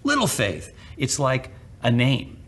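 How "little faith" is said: usual speaking voice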